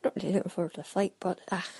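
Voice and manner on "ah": ordinary delivery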